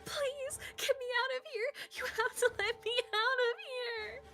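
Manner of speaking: high, loud, tearful begging; screaming plea. Acted. June pounding on the locked door, high crying scream. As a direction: scream desperately to be let out. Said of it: "She screamed at the door".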